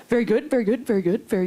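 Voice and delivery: repetitive fan, stepping gradually down; lightly mocked fan at a loss for words; imitating awkward fan. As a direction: imitate an awkward stranger stuck on one phrase. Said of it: "And he kept saying".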